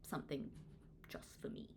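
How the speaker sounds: fading into a soft whisper. Acted. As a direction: let the last words dissolve into a whisper. Closing line fading to whisper.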